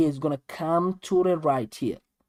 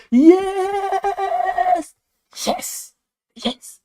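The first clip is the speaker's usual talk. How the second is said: high, loud burst of joy; excited cheer; 14 semitones higher, 4 dB louder, much slower than usual. Real, not acted. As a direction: celebrate the right answer. Student gets answer right, excited.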